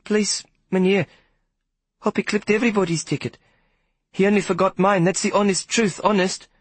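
put-on very high, earnest pleading boy; boy pleading to protect Hoppy. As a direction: beg him to believe you. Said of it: "I pleaded".

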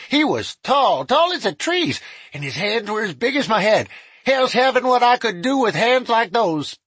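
put-on high, cackling old crook; gleeful, high old killer.

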